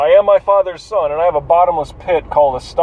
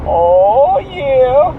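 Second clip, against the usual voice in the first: pleased oh yeah; 9 semitones higher, 4 dB louder than usual. Real, not acted. Cool air hits, pleasure.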